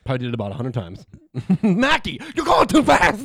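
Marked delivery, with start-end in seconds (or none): 1.6-3.2 high, loud whiny scold; high mock director scolding. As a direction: yell at the actor in a high voice.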